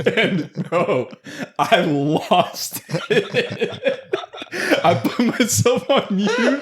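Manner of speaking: high breathless giggling; can't stop laughing while talking. Real, not acted. Cracking up retelling funny call.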